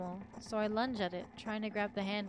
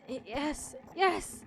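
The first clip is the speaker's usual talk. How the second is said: whimpering reply; 5 semitones higher, much slower than usual. A narrator's reading. Injured narrator tries to reply to the voice, stammering into a high cry. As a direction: struggle to get the answer out. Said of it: "stifled whimpers come out of my throat".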